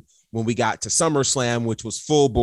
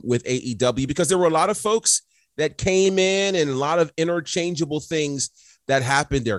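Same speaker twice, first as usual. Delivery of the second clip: ordinary talk, nothing marked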